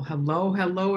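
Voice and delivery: ordinary talk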